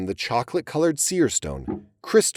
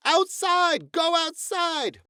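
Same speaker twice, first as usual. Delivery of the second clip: much higher, slow commanding shout; mother shouting; slower than usual. Acted. Lucy Mack orders her fighting sons outside, shouting much higher. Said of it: "while Lucy Mack shouted".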